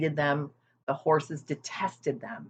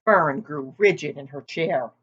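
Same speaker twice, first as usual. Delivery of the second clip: plain reading voice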